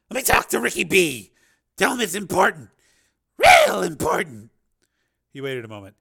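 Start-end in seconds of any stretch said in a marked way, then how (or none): 0.1-4.5 loud, higher, insistent pimp; pimp Rico. Acted. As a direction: push to get put through. Voices Rico on phone.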